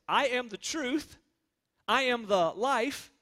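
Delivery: slow declaration from the pulpit; solemn declaration. Acted. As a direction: proclaim each claim slowly and weightily. Quoting Jesus' solemn claims for emphasis.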